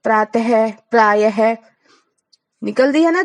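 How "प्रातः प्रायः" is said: अतः प्रातः said with a clear breathy ह at the end; visarga as ह. Shown as a demonstration. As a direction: end each word in a puff of ह. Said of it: "है की तरह बोल रहे हैं". (विसर्ग को ह की तरह बोलें)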